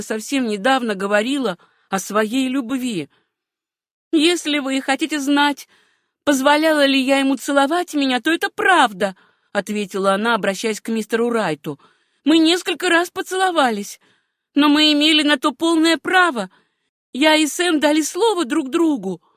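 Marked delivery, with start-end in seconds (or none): 4.1-9.2 put-on high, steady girl's voice; defiant young woman. A narrator's reading. Niece answers Mr Wright's charge, high-voiced declaration. 12.2-19.2 put-on high, steady girl's voice; defiant young woman. A narrator's reading. Niece answers Mr Wright's charge, high-voiced declaration.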